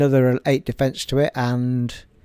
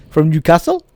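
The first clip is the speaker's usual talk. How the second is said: Newcastle lilt on "From Newcastle?", rising at the end; a quick try at a Geordie "Newcastle"; Geordie accent; 4 semitones higher, 7 dB louder than usual. Shown as a demonstration. A Geordie lilt to viewers. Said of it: "the only word I can do in a Newcastle accent".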